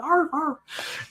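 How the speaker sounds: put-on high yappy little-girl arf, arf; imitating a dog's bark. Little girl barking like a puppy under the kitchen table. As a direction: play at being a puppy barking. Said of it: "but you go".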